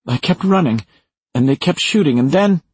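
high, urgent, building to a break-off; fearful retelling. Acted. Maura telling Leo how she kept running, high and tense. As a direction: push the pitch up as the memory builds. Recounting flight from gunfire.

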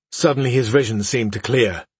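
usual speaking voice

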